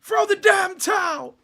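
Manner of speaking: high, slow, shouted trainer line; Rocky movie shout. Retold or imitated. Streamer quoting Rocky's trainer, big shout. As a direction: bellow it like the corner man in Rocky. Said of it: "you've even got the quotes, of Rocky".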